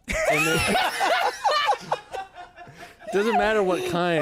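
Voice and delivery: high, breaking into laughter; laughing. Real, not acted. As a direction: talk through giggles. Cracking up mid-explanation.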